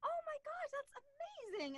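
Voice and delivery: excited discovery. Retold or imitated. Recalling learning cartoon voices are a job, thrilled. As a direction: react like a kid who just learned her dream job exists. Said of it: "I remember finding out".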